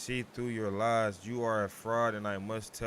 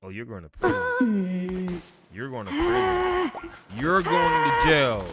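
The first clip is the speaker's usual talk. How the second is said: higher, louder, slow taunting verdict; mock sentencing; 10 semitones higher, 11 dB louder, slower than usual. Cult leader sending a traitor to prison, loud and gloating. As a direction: gloat as you send him away.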